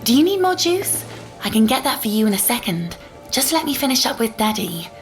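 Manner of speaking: lilting mommy voice, high on the question; coaxing a child. Acted. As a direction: placate a little one sweetly. Mother coaxes her small daughter.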